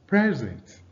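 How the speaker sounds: PRESent, first syllable high, then falling; stress on first syllable. Shown as a demonstration. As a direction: punch the PRES in present. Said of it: "stress on the first syllable".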